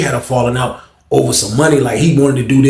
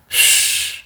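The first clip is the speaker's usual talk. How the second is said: a quick unvoiced shh; hushing whisper; 5 dB quieter than usual. Hushing his cellmate so nobody overhears, with a breathy shh. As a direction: hush him before anyone hears.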